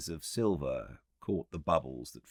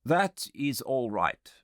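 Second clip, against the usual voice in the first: higher, slow agreeable voice; slow, pitched-up concession; psychologist; 10 semitones higher, 4 dB louder than usual. Acted. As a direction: grant the point lightly. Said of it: "said the psychologist".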